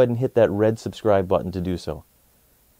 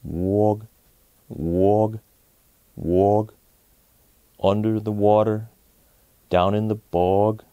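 frog voice; 2 semitones lower, much slower than usual. A narrator's reading. Frog tells the bunny it lives in the bog. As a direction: croak it slowly like a lazy frog. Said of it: "sang the frog".

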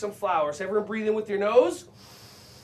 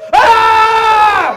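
big high held shout; loud high shout; 11 semitones higher, 15 dB louder than usual. Shown as a demonstration. Teacher ending the warm-up on a loud high cry.

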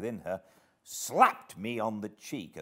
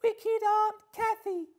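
put-on very high, slow wailing toddler voice; loud, high-pitched tearful child; sobbing small child; 6 dB louder, slower than usual. A narrator's reading. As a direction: wail the complaint like a little child. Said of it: "commenced crying himself and sobbed out complaints".

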